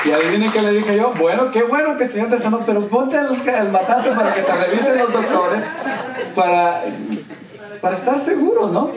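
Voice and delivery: high-pitched, laughing through the quote; laughing retelling. Retold or imitated. Pastor quoting what he told the healed woman, laughing and high. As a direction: giggle your way through the advice.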